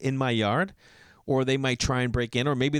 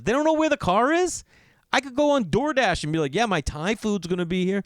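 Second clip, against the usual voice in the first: exasperated rant; 8 semitones higher than usual. Real, not acted. Telling the crowd police know less than DoorDash, exasperated.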